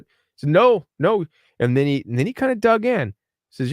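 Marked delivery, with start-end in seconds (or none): 0.5-1.2 a sharp, louder, higher "No, no" from the in-law; insistent denial. Retold or imitated. In-law flatly denies the golf time, insistent and louder. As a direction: push back hard, retold.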